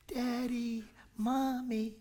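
put-on high, childlike calling of parents; little kid voice. Preacher imitating a little kid calling parents, childlike. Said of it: "a little kid going".

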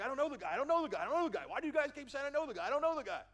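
loud, high-pitched, exasperated protest; insistent repeated denial. Retold or imitated. Peter denying Jesus to the bystanders, loud and exasperated. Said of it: "He repeatedly denied".

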